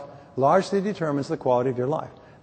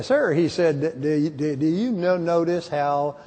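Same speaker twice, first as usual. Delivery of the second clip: lightly voiced slow Southern drawl, stretched out; a hint of an Alabama drawl, drawn-out; mock slow Southern speaker; slower than usual. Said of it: "fellow from Alabama said".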